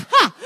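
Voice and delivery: high, sudden "ha" into real laughter. Real, not acted. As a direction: burst out laughing at your own joke. Laughs at her own defiant joke.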